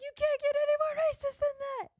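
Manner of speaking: shocked disbelief at the line; incredulous outrage. Real, not acted. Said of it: "outraged reaction to racist line".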